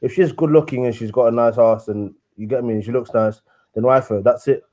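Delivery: ordinary delivery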